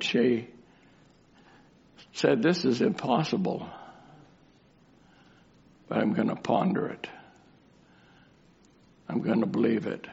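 low, soft voice with long pauses; hushed reflective preaching. Real, not acted. Preacher urging doubters to leave it to him, hushed and reflective. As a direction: draw the listeners gently into surrender.

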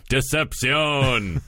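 drawn-out, high, dramatic reveal; dramatic villain reveal. Acted. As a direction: stretch it out like a gloating villain.

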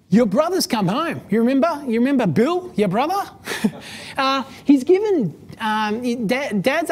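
eager servant voice breaking the big news; excited servant. Retold or imitated. Servant tells the older brother his brother's home, excited.

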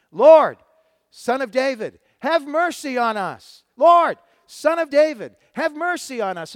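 loud, high desperate cry for mercy; shouting. Acted. Two blind men crying out to Jesus, shouting. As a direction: call out loudly over a crowd. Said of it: "they began to shout".

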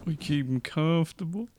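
put-on Southern accent, hushed and comforting. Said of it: "doing his comforting Southern voice".

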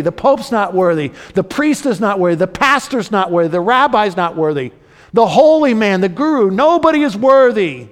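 high, loud pulpit questions and answers; impassioned preaching. Real, not acted. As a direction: drive it home to the congregation, building to a cry. Preacher builds to full force.

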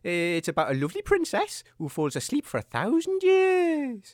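normal talking voice

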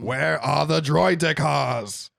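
loud, drawn-out character line; movie quote. Acted. Quoting a film line to the co-hosts, drawn-out character voice. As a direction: declaim it slowly like a quoted villain. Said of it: "That's a good quote".

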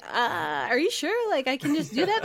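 unsure, asking if it's really allowed; incredulous. Retold or imitated. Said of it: "I was like".

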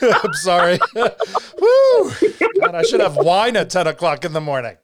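giggling apology, breaking into a whoop; laughing. Real, not acted. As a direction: laugh it off through real tears. Laughing through emotional moment.

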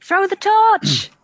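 high, loud, drawn-out yell; urgent shout. Acted. Player urging an ally against the owlbear, urgent shout. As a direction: shout an urgent battle order.

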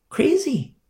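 very high, exasperated; exasperated cry. Real, not acted. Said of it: "preacher exclaims".

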